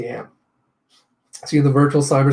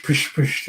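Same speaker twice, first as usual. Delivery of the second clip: whispered pish effect; 3 dB quieter than usual. Retold or imitated. Sound of fading away.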